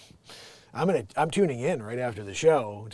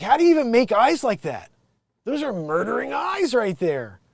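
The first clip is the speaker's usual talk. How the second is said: alarmed rant; 9 semitones higher, 7 dB louder than usual. Real, not acted. Ranting over suspect's eyes.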